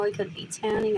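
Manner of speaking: ordinary delivery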